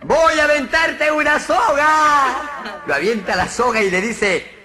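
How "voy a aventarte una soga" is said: agudo, lento y fuerte; voz de personaje. Acted. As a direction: gritarle al de abajo desde arriba. Chiste, personaje de arriba grita. (el de arriba grita hacia abajo, lento y agudo)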